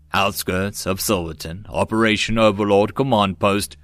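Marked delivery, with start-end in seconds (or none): none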